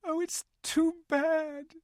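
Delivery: high, slow tearful lament; sobbing. Acted. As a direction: break down over the loss. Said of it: "sobbed Lucy".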